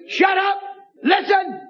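high-pitched exclaimed command; retold sharp rebuke. Preacher to hollering woman, retold high-pitched rebuke. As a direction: re-enact cutting off a heckler, pitch jumping up.

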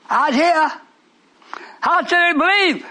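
rising preacher cadence, call and answer; sermon call for amens; preaching call. Acted. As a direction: preach it to the congregation.

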